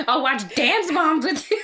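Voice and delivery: loud, high mock excitement breaking into laughter; playful shouted plea through laughs; hammed-up eager invitation. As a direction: belt out a silly, over-keen offer.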